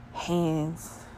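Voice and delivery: normal talking voice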